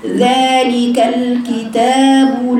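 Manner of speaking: slow, measured Quran recitation with long held vowels; drawn-out recitation. Shown as a demonstration. Teacher showing students the madd by ear, vowels held long. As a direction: stretch the vowels of ذلك الكتاب لا very slowly. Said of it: "عدم إعطاء المد زمنه حركتين".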